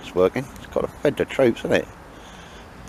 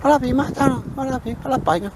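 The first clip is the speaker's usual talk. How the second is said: much higher, squeaky pretend dog voice saying he loves bacon; dog's voice. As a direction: voice the dog high and eager. Voicing the dog Baldrick.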